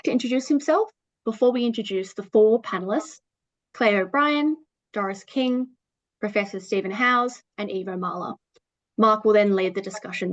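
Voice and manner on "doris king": normal talking voice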